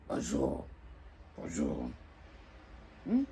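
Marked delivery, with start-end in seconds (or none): 0.1-1.9 cold grumpy bonjour. Shown as a demonstration. Woman brushing off a man's greeting, cold and closed. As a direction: mumble a cold, closed hello. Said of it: "Quand on te dit bonjour".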